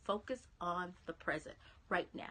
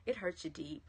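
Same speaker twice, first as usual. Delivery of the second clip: ordinary delivery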